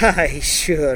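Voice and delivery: short laugh into the words; laughing reply. A narrator's reading. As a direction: scoff with a light laugh. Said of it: "He laughed".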